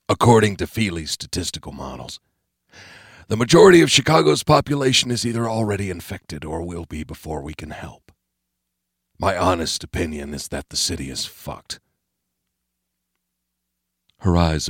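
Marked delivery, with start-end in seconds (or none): 0.1-11.8 blunt advisor. A narrator's reading. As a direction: lay out the grim numbers plainly. Murray gives blunt assessment.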